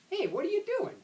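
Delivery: high, sharp demanding call. Acted. Sister catching brother in the act, demanding. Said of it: "she demanded".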